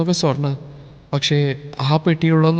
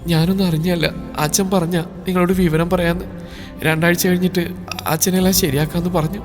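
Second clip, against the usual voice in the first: softer, tearful plea; crying; 2 semitones higher, 6 dB quieter than usual. Acted. Bride pleading with her husband, a touch of crying. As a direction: plead through tears, mildly. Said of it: "അവൾ കരയുന്നതും പറയുന്നതും".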